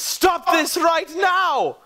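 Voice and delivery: high, slow shouted command; slow it down and command the crowd. Acted. Alabaster ordering the duel to stop, slow commanding shout.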